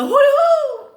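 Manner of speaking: loud, high hoo-hoo; sudden musical owl-like call; playful hoot. Pretend. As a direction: whoop it out long and high. Hoots playfully to end the saying.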